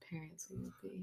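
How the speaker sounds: breathy whispered aside. Real, not acted. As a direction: drop to a whisper for the last words. Whispered aside about parenting.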